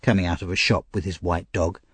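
ordinary delivery, nothing marked

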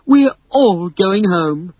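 put-on Wendy comforting the homesick boys. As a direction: reassure them gently. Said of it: "said Wendy".

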